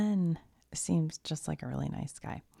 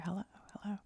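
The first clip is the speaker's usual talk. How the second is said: high, soft repeated hello; calling out tentatively; 5 semitones higher, 8 dB quieter, much faster than usual. Retold or imitated. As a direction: softly check if anyone is listening. Retells woman calling for production crew.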